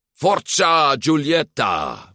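bellowed, high and loud; father roaring his daughter on at the race; roaring cheer. A narrator's reading. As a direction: roar it from the crowd at your daughter. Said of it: "brulde haar vader".

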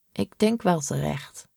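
usual speaking voice

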